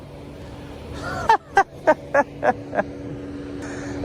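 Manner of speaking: long, high-pitched laugh; derisive laughter. Real, not acted. Detained man laughs at officer's reason, derisive. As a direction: laugh at length in disbelief. Scoffing at being detained for clarification.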